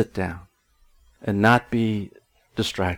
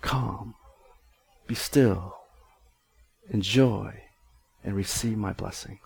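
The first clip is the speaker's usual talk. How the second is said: calm voice of God; 9 dB quieter than usual. Acted. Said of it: "And God says".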